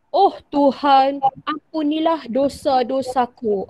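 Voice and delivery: slow, earnest plea to God; pleading prayer. Shown as a demonstration. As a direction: beg for forgiveness, slowly. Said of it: "untuk perasaan mengharap". (doa penuh mengharap, perlahan)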